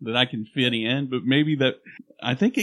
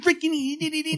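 put-on gibberish accent. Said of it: "so many accents".

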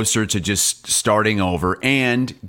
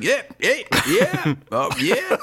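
high, lightly mocked agreement; Golic, agreeing blindly; 6 semitones higher, slower than usual. Host imitating Golic agreeing with the fan, high and laughing. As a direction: lightly imitate someone going along cluelessly, breaking into laughter.

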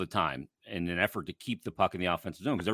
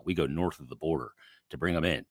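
normal talking voice

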